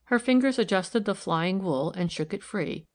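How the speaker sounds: ordinary delivery, nothing marked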